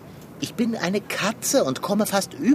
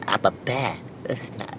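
ordinary talk, nothing marked

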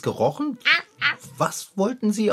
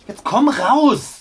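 stern command; 5 semitones higher, 3 dB louder, much slower than usual. Acted. Scolding the cat under workbench.

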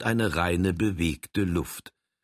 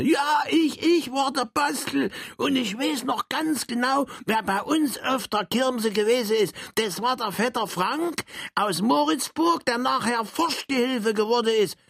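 high, eager little Saxon man. Acted. Saxon character boasts to Frank, high and eager. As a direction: squeak it out like a proud little Saxon.

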